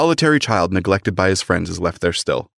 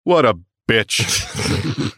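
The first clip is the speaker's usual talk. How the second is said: drawn-out mock disdain; much slower than usual. Mocking Scrooge for crying.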